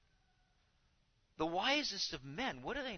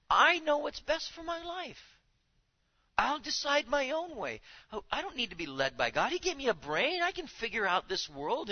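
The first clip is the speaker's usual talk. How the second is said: high, loud, cocky voice of a proud man; mock a man who thinks he knows best; arrogant proud man; 9 semitones higher, 5 dB louder than usual. Preacher mocking the proud man who needs no God, loud and high.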